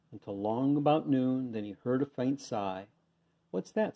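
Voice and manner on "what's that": high, sharp royal question; startled king snapping a question; snapped question. A narrator's reading. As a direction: snap it out, pitch jumping up. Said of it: "snapped the king".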